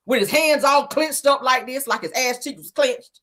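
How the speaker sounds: ordinary delivery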